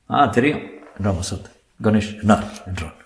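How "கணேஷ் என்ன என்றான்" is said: ordinary delivery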